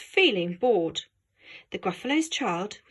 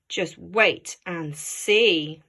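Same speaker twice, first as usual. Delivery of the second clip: low, slow and knowing; sly mouse; 4 semitones lower, much slower than usual. Acted. Mouse promising a friend, slow sly warning. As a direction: draw it out teasingly.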